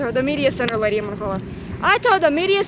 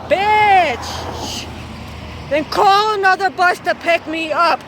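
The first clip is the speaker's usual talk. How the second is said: angry retort; 4 semitones higher, slower than usual. Retold or imitated. Student snapping at bus transportation on the radio. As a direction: snap it at them, furious.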